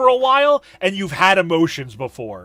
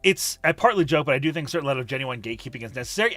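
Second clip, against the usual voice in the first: normal talking voice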